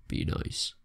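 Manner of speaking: soft unvoiced whisper of "Be nice"; whispered plea. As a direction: breathe "Be nice" like a secret plea. Pleading with game merchant.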